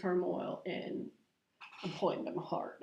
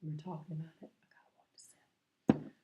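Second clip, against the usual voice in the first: whispered confession; conspiratorial whisper; 3 semitones lower, 4 dB quieter, faster than usual. Real, not acted. To her viewers, admitting soda temptation, whispered. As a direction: lean in and whisper the secret.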